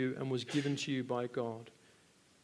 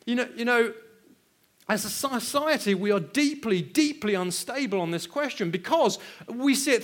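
higher, louder full-force preaching; impassioned preaching; 10 semitones higher, 10 dB louder than usual. Acted. Preacher rouses the congregation about sex, loud and fervent. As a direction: drive it home to the congregation.

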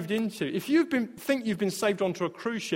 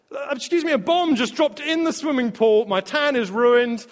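higher, indignant hammed-up whine; mock complaining tourist; 5 semitones higher, 4 dB louder than usual. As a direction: complain to the captain like a fussy passenger.

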